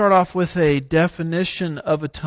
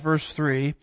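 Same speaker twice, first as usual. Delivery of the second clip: usual speaking voice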